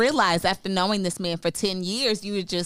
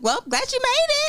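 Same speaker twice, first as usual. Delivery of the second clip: very high sing-song sarcasm; sweetly sarcastic, pitched way up; mock-sweet greeting, very high; sarcastic welcome; faster than usual. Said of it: "But then I laugh".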